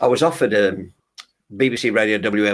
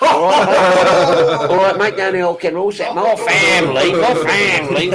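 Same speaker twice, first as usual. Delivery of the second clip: mock Brummie accent; 8 semitones higher, 6 dB louder, slower than usual.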